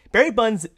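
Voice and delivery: Bonds slipping into "bunz"; flubbed "Bonds", rhymed with "buns"; mispronounced name. Real, not acted. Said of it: "what is going on with me".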